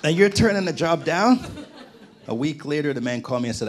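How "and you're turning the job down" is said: laughing imitation of a panicked wife, higher and louder; mock panic. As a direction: play the wife's alarm through a laugh. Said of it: "my wife was freaking out".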